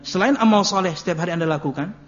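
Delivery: normal talking voice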